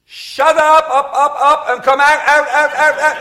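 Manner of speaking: very high, loud shout repeating like an echo; echoing command. Retold or imitated. Minister commanding demon in old building, echoing shout. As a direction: bellow the command and mimic its echo. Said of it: "when you command something, it keeps going".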